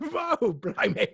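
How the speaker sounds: silly English exclamation; mock English accent. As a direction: play a cartoon Englishman. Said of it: "I can't do an English accent".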